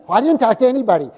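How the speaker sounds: quick, high, loud protest; defensive denial. Acted. Church member answering the pastor's question, defensive denial.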